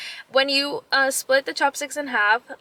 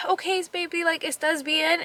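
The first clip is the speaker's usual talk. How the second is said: soft, higher-pitched voice to a little one; soothing mom; 5 semitones higher, 3 dB quieter than usual. Retold or imitated. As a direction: gently check on an upset child, retold. Soothing her crying son.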